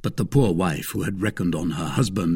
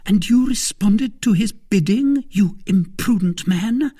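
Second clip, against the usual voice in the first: high, louder, reproachful woman's voice; scolding wife; 12 semitones higher, 6 dB louder than usual. Acted. Wife scolding her imprudent husband, aghast. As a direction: rebuke him in disbelief.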